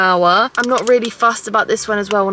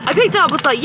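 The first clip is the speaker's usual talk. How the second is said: ordinary talk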